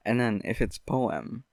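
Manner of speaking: ordinary delivery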